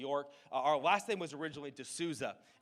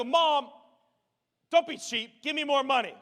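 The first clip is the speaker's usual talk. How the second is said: mock demanding kid; 11 semitones higher, 11 dB louder than usual. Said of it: "I didn't say that".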